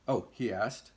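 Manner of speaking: plain reading voice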